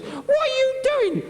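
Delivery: hoge, langgerekte gil; shrieking woman. Acted. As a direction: krijs het hoog en traag uit. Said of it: "And he goes".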